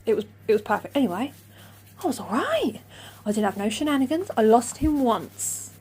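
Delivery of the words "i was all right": high whispered relief. Real, not acted. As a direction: breathe it out relieved. Relieved aside.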